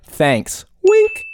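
a flat thanks, then a squeaky high wink; playful wink. Retold or imitated. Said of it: "And they're like".

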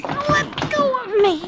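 high, slow, panicked plea to be released; frightened cry. Acted. Woman grabbed by detective cries in fear. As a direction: wrench free, pleading in fear. Woman struggling in man's grip.

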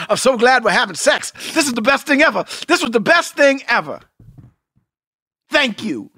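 hammed-up thrilled husband mid-sex; mock overjoyed. Husband imitating himself gushing to wife, mock overjoyed. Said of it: "I'll be like".

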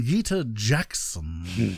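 introducing someone in drawn-out, savoured syllables; slow, drawn-out introduction. Shown as a demonstration. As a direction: stretch the name out like a hammed-up introduction. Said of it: "I talk like I'm introducing".

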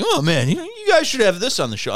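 high, excited mock plea; eager retold friend. Tipsy friend pitching himself for the show, mock eager.

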